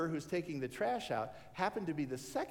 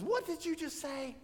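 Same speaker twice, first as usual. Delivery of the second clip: high, startled "what did you just say"; a startled barber asks his customer; startled question; 11 semitones higher than usual. Acted. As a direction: spin round in disbelief.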